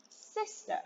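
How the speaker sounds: soft whispered Sister, high and breathy. Shown as a demonstration. Teacher whispers Sister to the children. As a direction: whisper the word Sister like a secret. Teacher models word for kids.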